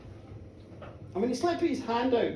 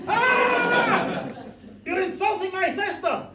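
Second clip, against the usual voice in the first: angry shout; 8 semitones higher, slower than usual. Acted. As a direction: shout the accusation in his face. Outraged drunken quarrel.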